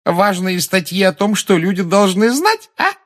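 high, loud taunting echo; jeering, high-pitched parroting; mocking. A narrator's reading. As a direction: throw the phrase back with open derision. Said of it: "произнесла она с издёвкой".

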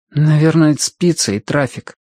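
plain reading voice

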